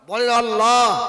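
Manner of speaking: a loud, drawn-out cue to repeat; loud prompt. Real, not acted. Preacher prodding reluctant congregation to say God's name, loud call. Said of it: "নামটা নিতে আপনাদের কষ্ট হচ্ছে".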